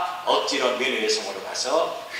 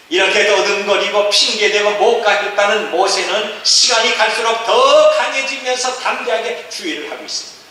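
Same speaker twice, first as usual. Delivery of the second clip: higher, driving sermon delivery; forceful preaching; 8 semitones higher, 6 dB louder than usual. Acted. (힘주어 외치는 설교)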